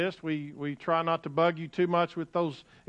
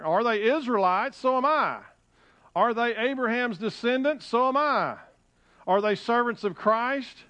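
higher-pitched rhetorical boasting; bragging; 6 semitones higher, 3 dB louder than usual. Acted. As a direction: throw out each question and cap it proudly. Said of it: "A little, little bragging here".

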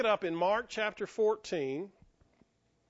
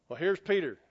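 ordinary talk, nothing marked

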